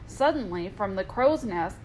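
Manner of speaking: ordinary delivery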